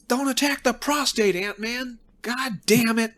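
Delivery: high, exclaiming mock panic; acted alarmed outcry. Warning Ant-Man off the prostate, high hammed-up alarm. Acting out a battle cry.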